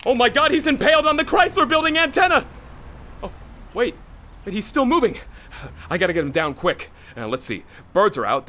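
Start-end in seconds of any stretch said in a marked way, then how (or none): 0.1-5.2 high frantic calls; panicked shouting. Acted. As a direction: yell in panic. Rescue chaos.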